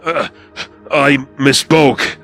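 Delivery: high, loud, slow, strained creature voice; pained apology. Acted. Injured lieutenant backs down before commander, pained. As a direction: force it out in pain. Kaisel in pain, threatened.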